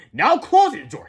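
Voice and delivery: high, loud mocking announcement; taunting. A narrator's reading. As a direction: flaunt it defiantly. Teacher taunts, demonstrating door.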